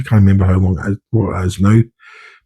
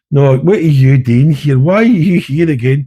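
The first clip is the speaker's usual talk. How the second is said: slow, exasperated questioning, pitched up; 5 semitones higher, slower than usual. Retold or imitated. Sergeant to a returning trainee, exasperated. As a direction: drag it out in disbelief at a repeat offender.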